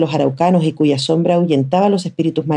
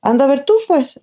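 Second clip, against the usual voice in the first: much higher, bossy challenge; imperious retort; 10 semitones higher than usual. A narrator's reading. Older sister daring the boy to go look. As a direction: dare him, commanding. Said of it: "replicó de inmediato la Tuca, imperiosa".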